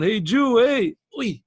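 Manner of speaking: calling down. Retold or imitated. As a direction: shout down to reach someone far below. Imagined call down manhole.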